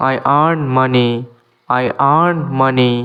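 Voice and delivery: slow, clear English for learners; careful dictation pace; slow teaching demo. Shown as a demonstration. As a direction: spell each word out slowly for students. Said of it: "এখানেও ই এর উচ্চারণ হবে না".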